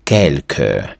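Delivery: "quelque" with qu as k and an unstressed ending; low, careful model of "quelque"; French reading. Shown as a demonstration. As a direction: say "quelque" in French, last e weak. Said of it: "І слово читається як".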